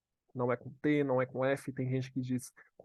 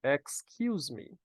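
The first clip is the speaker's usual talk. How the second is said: slow, drawn-out Excuse me; slow model phrase; much slower than usual. Shown as a demonstration. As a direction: stretch it out for the class. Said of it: "repita comigo".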